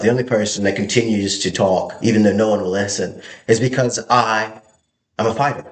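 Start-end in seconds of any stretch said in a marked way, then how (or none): none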